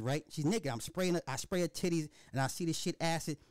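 usual speaking voice

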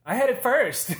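high, loud mock protest; put-on high-pitched outburst; mock indignant yell. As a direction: shout it like an outraged kid. Acting out childish protest.

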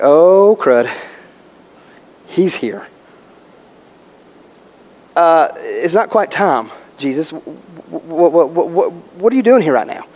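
low, hesitant, stumbling stammer; stammering demons. Acted. Demons caught off guard by Jesus, nervous stammering. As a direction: stall and stumble over the words. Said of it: "put stuttering in the scripture like that".

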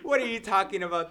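loud, high, laughing disbelief; incredulous protest. Real, not acted. Protesting a friend's remark about being seen from behind, incredulous. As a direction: call a halt in amused shock.

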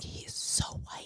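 breathy whisper under the breath; whispered joke to the ex-husband; whispered aside. Retold or imitated. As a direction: hide it from the kid, whisper it. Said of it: "I was like".

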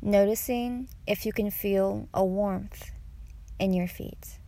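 guided meditation. Acted. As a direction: guide them unhurriedly through each breath.

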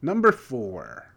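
loud, slow call, FOUR stretched out; big drawn-out FOUR; showy announcement of FOUR. Real, not acted. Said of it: "Why'd you say four like that?".

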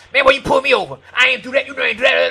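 loud, high-pitched confrontational outburst; harsh, angry protest. Acted. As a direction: argue back hotly at the officer. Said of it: "a harsh word stirs up anger".

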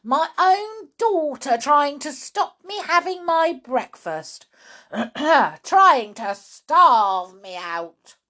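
aggrieved grandma outburst; indignant shouting. Acted. Grandma accuses her own daughter of starving her, indignant. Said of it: "shouted Grandma".

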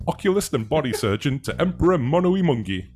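grand flamboyant showman. Acted. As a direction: announce it like a circus ringmaster. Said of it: "that's my showman voice".

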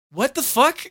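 high, loud imitation of a stunned friend; mock shock. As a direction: act out utter bafflement at being fooled. Friends' shock at the dropped accent.

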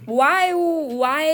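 high, loud, insistent asking; exasperated self-questioning. Retold or imitated. Speaker taking herself to task, exasperated. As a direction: demand an answer from yourself.

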